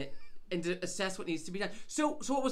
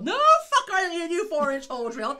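much higher and louder, laughing through it; half-laughing shout at the coring guy; exasperated yell; 8 semitones higher, 10 dB louder than usual. Retold or imitated. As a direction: shout it in disbelief.

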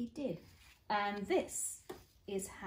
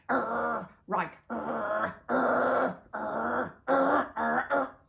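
dog growls; 3 semitones higher, 3 dB louder, much slower than usual. Acted. Dog torn between directions.

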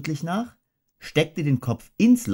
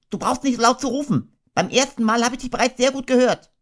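much higher, quick fussy rabbit; a prim rabbit scolding from inside, fast; high, hurried, huffy little voice; 14 semitones higher, faster than usual. Acted. Rabbit voiced, hiding in hole.

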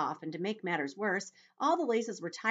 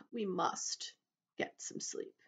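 put-on soft, halting elderly grasshopper voice; old grasshopper; 3 semitones higher, 5 dB quieter, slower than usual. Old grasshopper sending everyone to bed, soft and halting. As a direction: say it softly, with weighty pauses.

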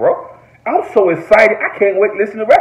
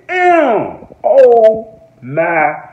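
stunned damn; 4 dB louder, much slower than usual. Retold or imitated. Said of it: "I said".

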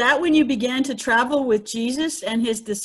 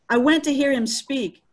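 ordinary delivery

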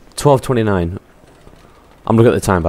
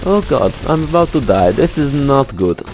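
higher, sing-song hammy Russian; mock Russian accent; 4 semitones higher than usual. Gamer struggling to keep his Russian going, mock Russian accent. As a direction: keep up a wobbly fake Russian. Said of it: "I cannot speak any more Russian".

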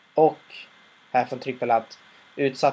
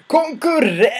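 much higher yelled single word; outraged shout; 12 semitones higher, 5 dB louder, slower than usual. Retold or imitated. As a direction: explode at the news.

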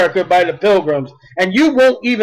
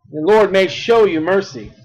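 usual speaking voice

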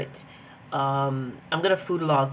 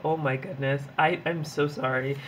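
usual speaking voice

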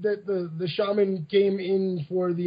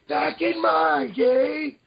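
put-on slow, higher old geezer voice; old person voice; 6 semitones higher, much slower than usual. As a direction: creak it out like a grandpa. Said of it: "start it out in the old person voice".

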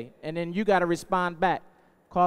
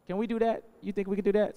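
ordinary talk, nothing marked

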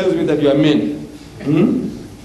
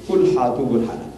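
Quran recitation; 4 semitones lower, much faster than usual. Retold or imitated. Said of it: "And Allah is telling you".

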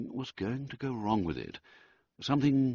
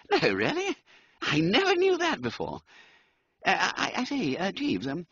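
high, hesitant toff with a laugh; an embarrassed Bertie fishing for sympathy; stammering upper-class young man; nervous young gentleman; 8 semitones higher than usual. Acted. Wooster chats nervously about girls.

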